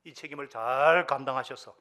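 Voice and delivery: loud, driving pulpit exhortation; impassioned sermon climax; full-force preaching. Real, not acted.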